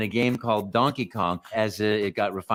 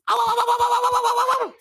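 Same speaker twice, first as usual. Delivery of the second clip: very high, loud yelps of pain; Mario getting hurt; 6 dB louder, much faster than usual. Shown as a demonstration. Mario hurt in a game, high loud yelps. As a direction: yelp like Mario taking a hit. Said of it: "I've been the voice of Mario".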